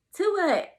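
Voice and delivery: high, loud incredulous question; imitated bewilderment. Husband asking doctor what he means, loud surprised question. Husband baffled by the news, acted.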